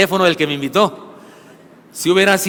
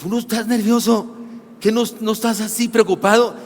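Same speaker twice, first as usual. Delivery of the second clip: higher, incredulous wife; a light imitation of his anxious wife; wife worried; 4 semitones higher than usual. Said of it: "mi esposa me dice". (esposa preocupada, voz algo más aguda)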